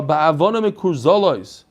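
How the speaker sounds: ordinary talk, nothing marked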